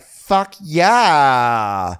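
high, loud, drawn-out cheer; mock enthusiastic yell. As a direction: whoop it, stretching the last word. Acting Rachel's enthusiastic acceptance.

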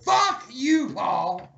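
high-pitched screamed curse; furious outburst. Acted. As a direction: screech it at your rival. Mock outburst at being outdone.

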